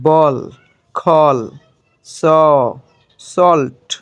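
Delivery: isolated model words, A sounded "aw" in বল and সল্ট. Shown as a demonstration. Teacher showing students A before L, "aw" in বল, খল, স, সল্ট. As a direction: say বল, খল, স, সল্ট with an "aw" vowel, one by one. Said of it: "এর উচ্চারণ অ এর মতো হয়".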